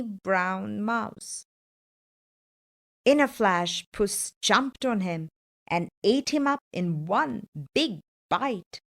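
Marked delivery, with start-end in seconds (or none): none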